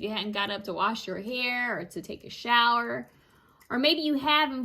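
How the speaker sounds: normal talking voice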